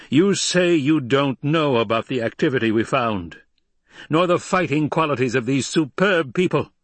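high, loud, imperious harangue; indignant Emperor. Acted.

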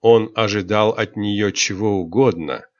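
ordinary delivery

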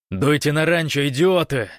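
high, loud furious order; yelling, voice straining. A narrator's reading. Гош orders his comrades to the ranch, yelling furiously. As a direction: yell the order till your voice strains. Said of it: "заорал Гош, срывая голос".

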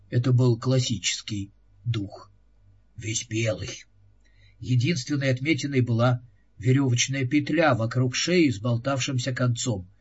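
ordinary delivery, nothing marked

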